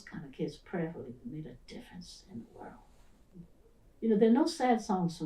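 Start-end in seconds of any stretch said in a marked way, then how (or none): 0.0-2.7 soft unvoiced whisper; whispered prayer. Real, not acted. Teacher hoping her pupils made a difference, whispered prayerfully. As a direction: breathe it like a quiet prayer.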